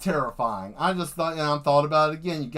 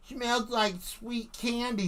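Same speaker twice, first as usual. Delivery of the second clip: mock Timmy; 7 semitones higher, 7 dB quieter, slower than usual. Kid Timmy sniffing paint.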